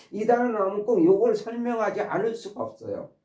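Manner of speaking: ordinary delivery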